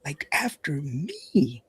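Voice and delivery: whispered, slow, conspiratorial aside; mock whisper. Host whispering to listeners that the realtor guest is after him. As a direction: hush it like a secret about the rival.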